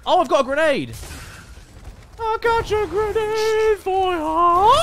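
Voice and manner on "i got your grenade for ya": gleeful taunt. Acted. Gleeful taunt tossing grenade.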